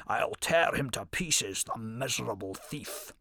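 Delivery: slow menacing growl, dropping away at the end; lion growling revenge on the thief. A narrator's reading. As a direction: grind out the threat. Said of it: "growled the angry lion".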